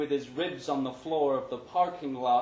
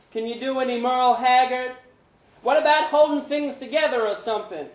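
put-on much higher, loud cheeky kid; street kid's voice; 12 semitones higher, 10 dB louder, faster than usual. A narrator's reading. Panhandling kid calling to Larry, high and loud. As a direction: call out to the passer-by.

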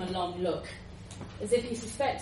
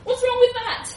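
offended squeak; 10 dB louder than usual. Acted. John offended.

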